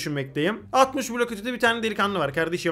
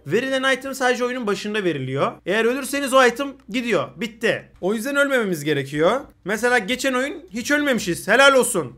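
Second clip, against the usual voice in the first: ordinary delivery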